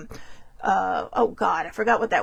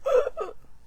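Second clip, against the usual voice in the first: 1 high frightened gasp; 4 dB quieter than usual. Retold or imitated. Reenacting her fright.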